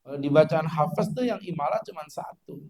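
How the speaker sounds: ordinary talk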